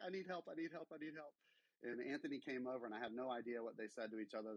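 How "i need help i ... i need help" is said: higher, urgent call for help, lightly played; imitating his own panic as he fetches a friend; retold panicked plea. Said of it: "I was like".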